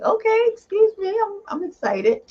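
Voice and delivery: high-pitched, playful excited squeal of a quote; excited reaction. Retold or imitated. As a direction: act out being thrilled, voice way up. Quoting own delighted reaction to casting.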